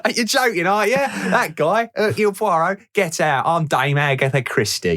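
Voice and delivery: high, put-on mock posh old lady voice; a badly done posh Agatha Christie voice; terrible accent. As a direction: ham up a silly posh English dame. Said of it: "and that's a terrible accent".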